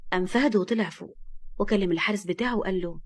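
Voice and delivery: ordinary delivery, nothing marked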